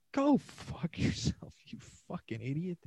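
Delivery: cracking up while telling him off; laughing insult. Real, not acted. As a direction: laugh through the curse. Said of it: "I'm like".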